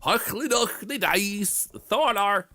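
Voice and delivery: garbled, high and loud mock Skånska accent; wide Skånska accent. Shown as a demonstration. Streamer showing how incomprehensible Skåne speakers sound, thick mock Skånska accent. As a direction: exaggerate a broad Skånska accent until the words blur. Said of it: "like, with really wide accent".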